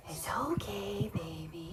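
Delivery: soft whispered reassurance; calm the frightened hen, softly; soothing a scared animal. Real, not acted.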